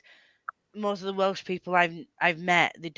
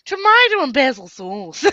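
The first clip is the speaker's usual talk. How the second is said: loud, high, hammed-up presenter voice; Lloyd Grossman; 7 semitones higher, 7 dB louder than usual. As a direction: exaggerate the drawn vowels of a TV chef. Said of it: "that's all I can say that sounds anything like Lord Grossman".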